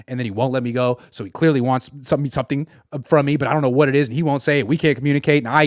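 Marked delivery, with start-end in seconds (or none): none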